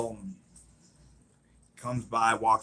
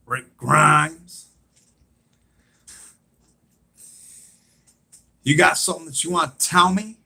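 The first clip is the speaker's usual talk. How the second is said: imitated confrontation, high and fairly loud; challenging retort; 5 semitones higher, 5 dB louder than usual. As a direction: throw the name back as a raised challenge. Acting heated challenge to the stranger.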